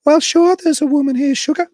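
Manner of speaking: high, loud falsetto Southern belle; mock woman's voice.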